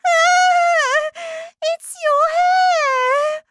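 very high, loud horrified cry; screaming. Acted. Wife horrified at her husband's hair, screaming. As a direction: wail it in horror. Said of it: "was screaming".

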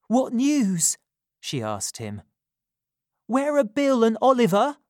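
anxious for news; young woman. A narrator's reading. Nancy asking anxiously.

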